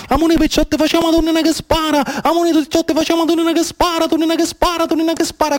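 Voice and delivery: put-on high sing-song call of young girls in the water; playful girls' shout across the rocks; children calling out. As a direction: call your friends to the game, high and bright. Girls calling to play at sea.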